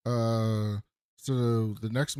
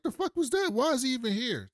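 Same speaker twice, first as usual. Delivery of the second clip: much higher, baffled and offended; incredulous Nolan; 11 semitones higher than usual. Retold or imitated. Nolan asking Mark why Oliver came, high and incredulous. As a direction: pitch it way up in disbelief.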